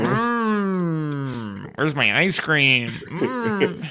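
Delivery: high, slow dreamy craving for ice cream; mock hungry moan. To friends, replaying his own movie reaction, mock craving moan. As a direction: hum it like you're dying for dessert. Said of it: "Did, did you say, "Mm"?".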